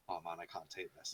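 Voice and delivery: low, hushed aside; retold muted dread. Gamer retelling his nerves at the light going off, muted dread. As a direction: mutter it low, as if to yourself. Retold reaction to scary scene.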